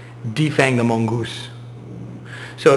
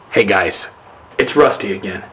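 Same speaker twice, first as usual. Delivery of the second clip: ordinary talk, nothing marked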